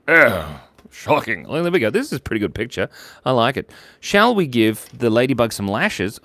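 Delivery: normal talking voice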